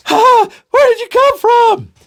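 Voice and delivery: very high, loud astonishment; startled gasp. Acted. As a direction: squeak it in shock. Johnny startled by the voice.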